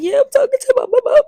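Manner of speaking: high, loud blubbering; exaggerated weepy whine; mock tearful reply. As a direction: blubber it out like a crying child. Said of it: "tears falling down my face".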